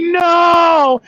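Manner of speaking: high, loud hammed-up no; mock refusal. Trucker refusing the cookies, high mock shout. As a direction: turn them down with a playful shout.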